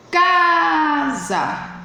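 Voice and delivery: "Casa" with a long, high CA; CAAA-sa, as a stress demo; stressed syllable drawn out. Shown as a demonstration. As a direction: hold the first syllable of "Casa", then drop to SA. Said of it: "pronunciado com força".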